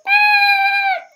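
sudden high loud scream. Acted. Scared squirrel screaming at the party guests. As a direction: shriek in fright.

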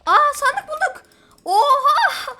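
loud, high yelp of joy with a laugh; excited shout. Real, not acted. Gamer finds a chest, loud excited shout. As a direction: burst out at the find.

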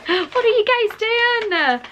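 high, slow, incredulous; delighted disbelief. Real, not acted. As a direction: drag it out, touched and amazed. Mock scolding generous friends.

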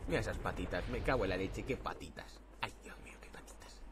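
unvoiced whisper, fading away; hushed marvelling. Real, not acted. Gamer marvelling at Link's little legs, whispering. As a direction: whisper it to yourself in wonder.

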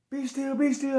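high, urgent order to freeze; high-pitched acted warning; acted police command. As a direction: imitate an officer yelling orders. Police with gun drawn shouting orders.

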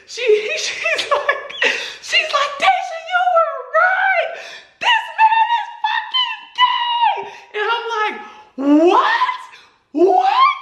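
very high laughing disbelief; high screaming shock, laughing; shocked squeal. Retold or imitated. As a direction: shriek the news through laughter.

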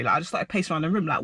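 ordinary delivery

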